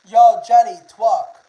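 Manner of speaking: loud call across the room; loud playful call. Real, not acted. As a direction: shout her name to get her attention. Calling out to a viewer.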